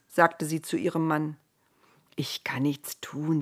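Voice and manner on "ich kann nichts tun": helpless whisper. Acted. Noah helpless answer.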